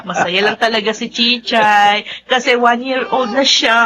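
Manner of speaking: normal talking voice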